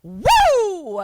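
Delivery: loud, high adrenaline whoop; long mock-macho "woo"; hyped-up bully whoop. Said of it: "get a lot of adrenaline".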